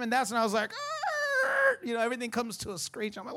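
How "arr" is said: sudden high yelp of alarm; shocked outcry. Retold or imitated. Preacher yelping at the pastor's routine, high outcry. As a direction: yelp like the brakes just slammed on.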